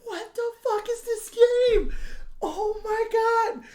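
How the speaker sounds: very high, slow incredulous wail; dragged-out shocked squeak; shrill disbelief. Retold or imitated. Retold disbelief at the game.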